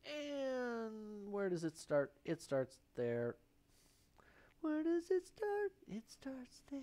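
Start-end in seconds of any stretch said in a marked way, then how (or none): 4.6-6.8 squeaky high, slowly drawn-out echo; silly high voice. Pretend. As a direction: repeat it in a playful, very high voice. Repeats the words in silly high voice.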